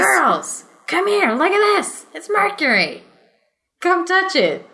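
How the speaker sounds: put-on high, sing-song calling the kids over; mock excited parent call. Imitating a parent calling the girls to play with mercury, high and excited. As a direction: mimic a careless parent showing off mercury.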